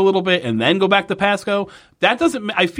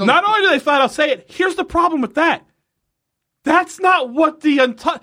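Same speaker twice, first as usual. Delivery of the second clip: exasperated rant; 11 semitones higher than usual. Real, not acted. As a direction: pitch jumps high in indignation. Ranting about the show's mistake.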